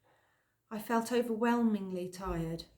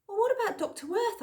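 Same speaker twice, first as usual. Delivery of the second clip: much higher, louder burst of an idea; sudden idea; 14 semitones higher, 5 dB louder than usual. A narrator's reading. Girl suddenly remembering another doctor, eager. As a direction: blurt it out as it strikes you. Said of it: "I said suddenly".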